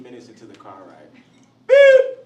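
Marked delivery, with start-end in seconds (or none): 1.7-2.2 short, piercing high beep; one loud, sharp siren blip; imitated police siren. Imitating police pulling up on the car, loud high beep.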